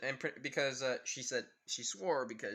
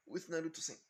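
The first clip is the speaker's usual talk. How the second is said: plain reading voice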